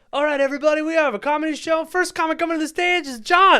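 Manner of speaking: high, loud hyped-up DJ voice; booming mock emcee call; DJ announcement. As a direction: announce the comic like a club DJ. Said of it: "And the D J went".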